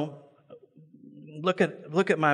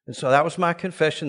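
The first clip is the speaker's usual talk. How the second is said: normal talking voice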